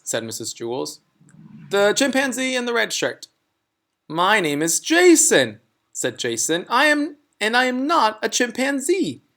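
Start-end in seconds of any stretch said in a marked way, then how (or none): 1.7-3.3 higher, brightly calling on a pupil; higher teacher voice. Acted. 4.1-9.2 put-on higher, louder indignant boy; a boy insisting he is no chimp; higher child voice, indignant; child character voice. Jason voiced in story.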